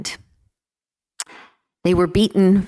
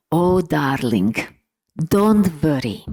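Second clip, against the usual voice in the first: slow, imitated Hungarian accent. Daughter quoting her father's reassurance, in his Hungarian accent. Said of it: "in his inimitable Hungarian accent".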